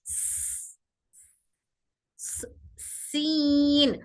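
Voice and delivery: स- hissed then सीन stretched long and thin; very slow thin सीन. Shown as a demonstration. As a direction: keep सीन light and fine. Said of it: "सीन बारीक पढ़ेंगे".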